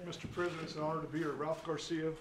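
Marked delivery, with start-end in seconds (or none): none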